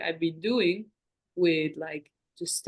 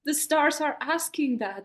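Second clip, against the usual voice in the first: high, loud plea of innocence; hammed-up excuse, pushed high and loud; mock protest; 7 semitones higher, 4 dB louder than usual. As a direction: protest loudly, pitched up, as a joke. Mock excuse, blaming the stars.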